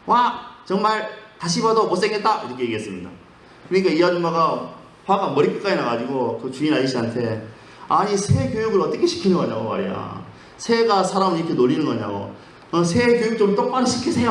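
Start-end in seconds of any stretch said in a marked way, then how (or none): 0.1-2.5 high, teasing parrot voice; a parrot mocking the lady; cheeky parrot. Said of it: "이렇게 했습니다". 7.9-14.3 annoyed complaint. Acted. Angry customer complains to owner.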